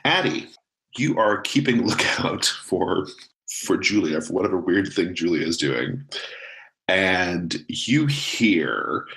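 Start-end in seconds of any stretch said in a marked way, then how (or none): none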